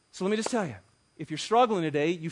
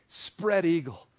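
ordinary delivery

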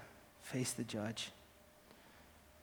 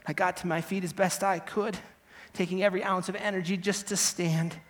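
normal talking voice

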